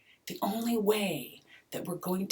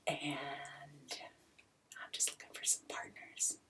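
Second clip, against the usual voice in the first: conspiratorial whisper; 4 semitones lower, 12 dB quieter than usual. Pretend. Conspiratorial gold rush pitch.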